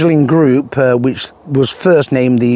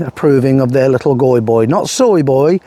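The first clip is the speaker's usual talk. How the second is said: ordinary talk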